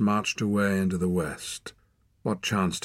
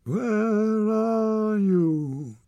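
high, drawn-out howl, stress on are; howled call; 4 dB louder, much slower than usual. Acted. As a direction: call out into the hills, stretched and despairing. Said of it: "Torak's desolate howl".

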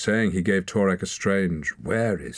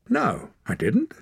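higher, bolder denial; confident; 12 semitones higher than usual. A narrator's reading. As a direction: deny it with confidence. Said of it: "He sounded a lot more confident".

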